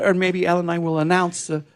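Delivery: ordinary talk, nothing marked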